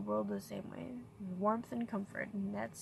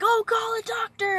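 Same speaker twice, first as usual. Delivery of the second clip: much higher, louder mock movie panic; movie drama parody; 13 semitones higher, 9 dB louder than usual. Mocking movie scenes with a hammed-up cry. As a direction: ham it up like a melodramatic film. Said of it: "You know how you see in movies".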